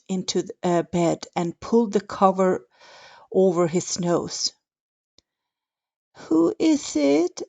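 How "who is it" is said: high, slow cackly granny put-on; wolf fakes a cackly old voice. Wolf in bed calls out to the knock in a high cackly voice. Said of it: "he called in a cackly voice".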